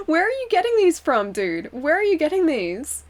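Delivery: high-pitched, incredulous reaction to a bad joke; amused disbelief. Real, not acted. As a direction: exclaim it in mock exasperation. Reacting to corny chat joke.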